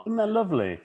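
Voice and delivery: high, sugary mock awe; mock sweet. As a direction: coo it sweetly.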